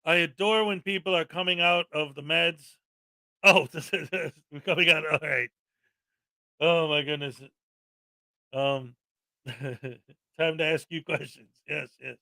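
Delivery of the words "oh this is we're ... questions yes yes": low, soft talk through laughter; real chuckling. Real, not acted. Host amused by viewers' chat messages, chuckling as he talks.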